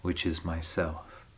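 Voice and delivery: very soft, low trailing end; hushed confession. Acted. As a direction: sink almost to nothing, as if confessing. Poem's bitter self-reference closes section.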